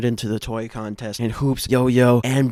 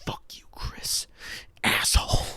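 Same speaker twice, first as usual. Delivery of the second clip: hissed under the breath, much softer; bitter whisper; 11 dB quieter than usual. Retold or imitated. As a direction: spit it out quietly after the rant. End of Hoops' angry rant.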